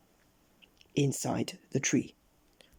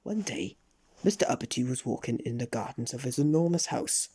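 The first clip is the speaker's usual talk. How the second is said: ordinary delivery, nothing marked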